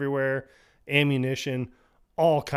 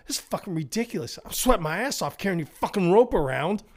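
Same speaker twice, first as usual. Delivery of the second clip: high, fast griping whine; whiny complaining brother; 7 semitones higher, faster than usual. Retold or imitated. Murphy griping at Connor about hauling his rope, high and fast. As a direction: gripe like you've had enough. Whining brother sweating in vent.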